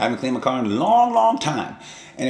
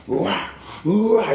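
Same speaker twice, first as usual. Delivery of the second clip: mock battle cry; 10 semitones higher than usual. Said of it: "looks like the ultimate warrior".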